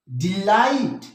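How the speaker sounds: Delight with the light part stretched and stressed; stress on second syllable. Shown as a demonstration. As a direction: lean hard on light in delight. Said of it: "हमारा stress आ रहा है".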